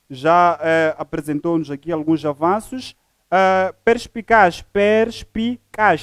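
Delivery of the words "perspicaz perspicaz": "perspicaz" drawn out slowly, syllable by syllable; split into syllables. Shown as a demonstration. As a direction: separate each syllable of "perspicaz" with a pause. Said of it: "são três sílabas".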